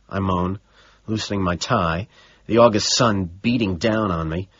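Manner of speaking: plain reading voice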